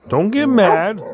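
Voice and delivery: high, loud playful yelp; playful alarm. Real, not acted. Owner reacting as the dog grumbles, high playful yelp. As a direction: pop up high and loud in play.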